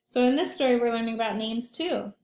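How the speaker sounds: ordinary delivery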